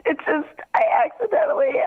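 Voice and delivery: crying on the phone. Real, not acted. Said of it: "Are you crying?".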